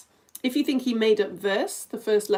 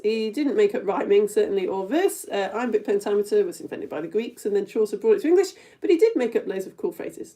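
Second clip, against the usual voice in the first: normal talking voice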